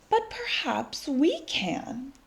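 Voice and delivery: slow, soft conspiratorial whisper; whispered secret. Acted. Miss Severs shares her treasure idea in a whisper. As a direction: hush it like a secret plan.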